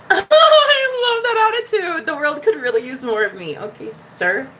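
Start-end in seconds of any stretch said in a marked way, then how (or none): 0.1-3.6 long high laugh spilling into words; delighted laughing. Real, not acted. Host loving a viewer's attitude, laughing high. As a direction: laugh loudly, then talk through it. Delighted, reads comment back.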